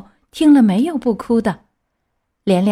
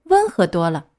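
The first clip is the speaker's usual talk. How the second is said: usual speaking voice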